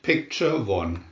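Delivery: plain reading voice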